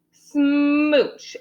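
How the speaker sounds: playful, elongated smooch; exaggerated smooch. A narrator's reading. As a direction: stretch it out like a big kiss. Acting out a big kiss.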